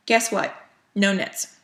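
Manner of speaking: usual speaking voice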